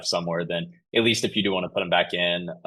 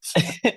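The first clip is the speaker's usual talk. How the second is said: laugh; 13 semitones higher than usual. Real, not acted. Laughs at being asked.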